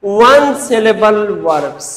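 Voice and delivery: वन syllable verbs said slowly, each word weighted; slow teacher's repeat of the rule; slow emphatic repeat. Shown as a demonstration. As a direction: spell out वन syllable verbs deliberately for the class. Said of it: "क्या?".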